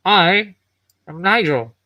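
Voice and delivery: lightly altered metal construct voice. Acted. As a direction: ask with curious, mechanical calm. Nigel explains himself.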